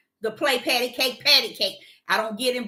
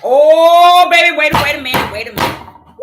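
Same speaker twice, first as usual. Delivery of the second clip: startled loud protest; 4 semitones higher, 14 dB louder than usual. Retold or imitated. Startled reaction to being rammed.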